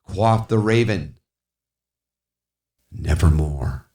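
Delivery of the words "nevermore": lower, dark croaked word; raven's reply. Acted. Raven answers the pleading speaker, low.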